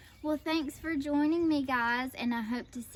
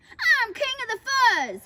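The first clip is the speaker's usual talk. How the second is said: loud, high snarled proclamation; snarling boast; 11 semitones higher, 8 dB louder than usual. A narrator's reading. Big Bark lords it over dogs, snarling. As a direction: crow it with a snarl. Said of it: "he snarled".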